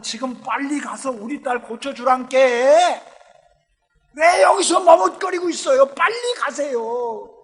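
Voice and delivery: rising to a high loud shout, drawn out slowly; urgent pleading. Acted. Jairus urging Jesus to hurry to his daughter, desperate shout. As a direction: beg him to hurry, desperate.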